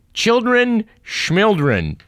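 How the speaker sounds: high, drawn-out scoffing sing-song; mocking dismissal. Host reading a sarcastic Onion headline, slow mocking scoff. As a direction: sneer it out slowly like a brush-off.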